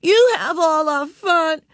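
high, loud, drawn-out tantrum; storming. A narrator's reading. Ramona storming at Beezus, loud high tantrum. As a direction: wail the accusation like a furious small child. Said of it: "stormed Ramona".

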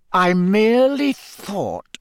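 slow, silky forced calm; tightly controlled, slow drawl; fake calm. A narrator's reading. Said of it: "in a voice of forced calm".

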